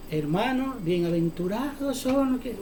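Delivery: voz muy suave, susurrada en broma; Cristo. Pretend. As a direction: imita una prédica apocada, bajando mucho el volumen. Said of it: "¿Tú crees que Cristo estaba hablando así?". (hablando bajito, en parodia)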